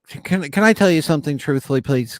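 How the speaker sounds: a hammed-up, bad Australian accent; terrible Australian accent. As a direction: butcher an Australian accent asking to speak honestly. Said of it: "That's a terrible, terrible Australian accent".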